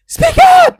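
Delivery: loud, very high mock teacher yell; shouting teacher. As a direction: bark the order at the student. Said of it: "il dit".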